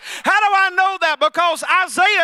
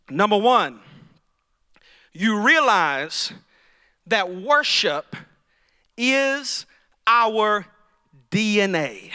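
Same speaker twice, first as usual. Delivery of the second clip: slow, deliberate; 6 semitones lower, slower than usual. Shown as a demonstration. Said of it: "Did you hear what I said?".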